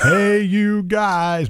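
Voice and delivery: high, slow sing-song TV greeting; drawn-out high children's show hello; Electric Company catchphrase. Retold or imitated. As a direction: stretch it out like a kids' TV host. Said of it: "I learned it from the electric company".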